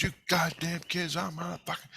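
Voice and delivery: high-pitched mock yelling, held back in volume; high mock rant at some kids; yelling man. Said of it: "he's fucking yelling at me".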